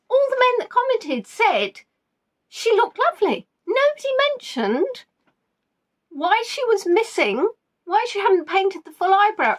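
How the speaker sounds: high-pitched, baffled disbelief; incredulous rant. Real, not acted. Host tells viewers about painted eyebrows, incredulous. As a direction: pitch it way up in comic bewilderment.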